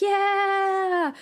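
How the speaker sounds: high cheering yell; crowd cheer. Acted. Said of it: "they're like".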